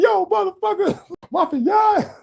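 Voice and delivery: high, shouted hype-man growl. Retold or imitated. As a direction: bark it like a Memphis rap intro. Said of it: "trying to get [name] to be the voice".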